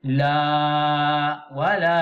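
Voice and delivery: La stretched long and loud; long vowel. Shown as a demonstration. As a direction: draw La out long. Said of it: "dibaca panjang". (La dibaca panjang)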